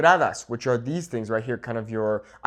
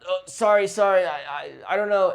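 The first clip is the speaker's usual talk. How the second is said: flustered stammer, mock panic; stumbling, higher-pitched repeated apology; freezing up; 4 semitones higher than usual. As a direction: act thrown by your mistake, apologizing in bursts. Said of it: "instead of freezing up and be like".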